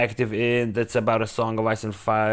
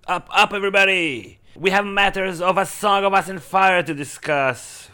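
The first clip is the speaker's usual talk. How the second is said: mock king's call; 6 semitones higher than usual. Playful king wake-up call.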